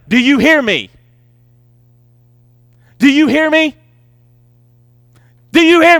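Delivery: exasperated parent building to a yell; climbing frustrated shout of do you hear me. Retold or imitated. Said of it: "You're yelling".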